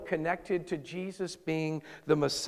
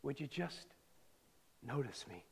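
hushed plea; 4 semitones lower, 17 dB quieter than usual. Retold or imitated. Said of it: "I'm sure he wasn't the only beggar".

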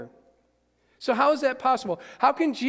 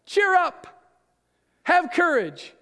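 high, loud call over the storm; 8 semitones higher, 5 dB louder than usual. Acted. Jesus calls to frightened disciples over the storm. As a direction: shout across the water to the boat. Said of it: "So Jesus says to them".

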